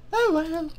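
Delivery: high-pitched mock British woman; female-voiced British accent. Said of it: "my horrible female voiced British accent".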